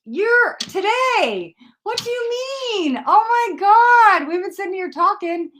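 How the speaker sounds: high, loud, drawn-out disbelief; astonished. Real, not acted. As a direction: exclaim in high-pitched amazement. Surprised viewer's reading is today.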